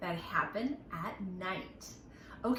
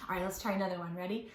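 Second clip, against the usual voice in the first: ordinary talk, nothing marked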